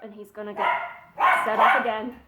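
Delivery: normal talking voice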